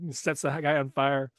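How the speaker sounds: ordinary talk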